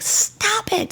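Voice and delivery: high-pitched mock scream, kept soft; screamed order, retold at reduced volume. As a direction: imitate her shriek without the volume. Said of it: "And she's screaming".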